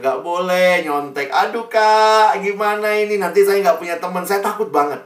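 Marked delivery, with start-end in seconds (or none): 1.3-4.3 a student begging his senior for help, panicky whine; anxious pleading. Retold or imitated. Student to senior, fretful pleading. Retelling own fear of losing friends. 4.3-5.0 higher, pleading admission of fear; fearful plea. Retold or imitated. Scared student confessing to his senior, pleading.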